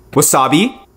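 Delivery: normal talking voice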